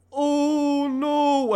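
high, feeble little dragon voice; a pathetic squeak from a huge ugly dragon; tiny wheezy monster going oh no; squeaky dragon. Acted. Dragon says oh no again.

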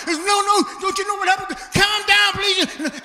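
high frantic shout; agitated pleading. Retold or imitated. Agitated man and friend in a heated exchange, frantic shouting. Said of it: "in a state of agitation".

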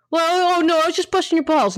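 high, fast mock voice of a dismissive teaser. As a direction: sneer it out like someone brushing off a hurt. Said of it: "when they say things like".

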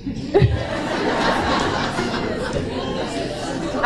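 long airy giggle rising high; high breathy laugh. Real, not acted. Telling friends about a bad date, laughing hard.

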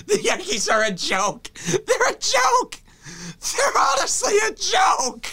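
high, strained, laugh-crying wail. Real, not acted. Fan laugh-crying about his own team. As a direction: break into tears of laughter over the joke team.

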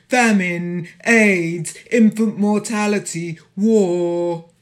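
loud, insistent litany; forceful declamation. Acted. Poet as Africa listing lands and losses, loud declamation. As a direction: proclaim each item with full voice.

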